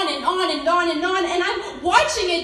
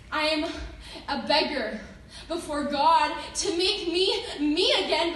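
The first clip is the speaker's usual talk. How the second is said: usual speaking voice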